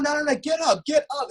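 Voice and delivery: high-pitched retold pleading; distressed urging. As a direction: beg the fallen fighter in disbelief. Retold plea to fallen fighter.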